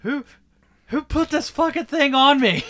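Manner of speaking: high, loud, strained outraged cry; outraged yell. Retold or imitated. Man with warthog strapped on, high outraged yell. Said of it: "It's like".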